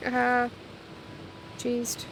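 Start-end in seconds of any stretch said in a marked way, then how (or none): none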